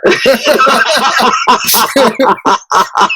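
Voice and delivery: long amused laugh; a real, hearty laugh. Real, not acted. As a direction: burst out laughing at the memory. Laughing at own story.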